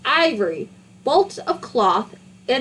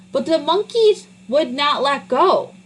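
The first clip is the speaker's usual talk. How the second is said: ordinary delivery, nothing marked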